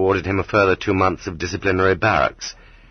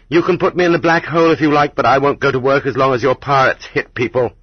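raised, higher, firm protest; defiant declaration; 6 semitones higher, 4 dB louder than usual. A narrator's reading. Gershuni defying the commandant, raised and insistent. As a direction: stand your ground and declare it.

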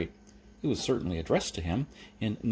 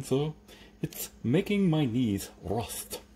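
lisping, persuasive servant; Igor; 4 semitones higher than usual. Acted. Igor urging Jeremy to hire him, lisping and deferential. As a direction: sell your services with a thick lisp, pitch lifted.

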